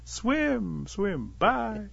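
high call easing off from a shout; high, singsong farewell at normal volume; sly send-off. A narrator's reading. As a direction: let the urging fade into a sly goodbye. Rabbit sees off the fooled Otter.

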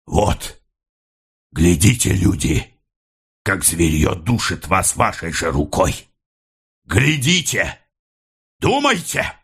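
separate, weighty appeals climbing at the end; heavy, hammering words. A narrator's reading. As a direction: drive each word home to the onlookers. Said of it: "бил тяжёлыми верными словами". (веско, рублеными призывами)

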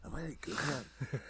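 soft, hushed Doctor whisper; whispering Capaldi. Retold or imitated. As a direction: murmur it like a drunk from the Gorbals. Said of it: "There's the, there's the whispering Capaldi".

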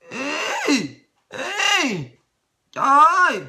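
high, squeaky pretend dismay; mock squeal. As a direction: squeal like an offended child. Mock reaction continues.

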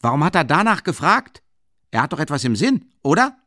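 high, loud, fast suspicious questioning; emboldened townsman. A narrator's reading. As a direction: egg the crowd on. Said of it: "der wieder Mut gefasst hatte".

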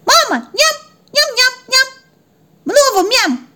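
put-on tiny, very high toddler squeak; baby puppy. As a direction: babble greedily like a hungry baby. Toddler puppy begging for food. (писклявый детский голосок малыша)

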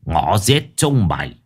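slow, menacing broken Vietnamese; broken foreign accent. Acted. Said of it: "bằng cái giọng lơ lớ".

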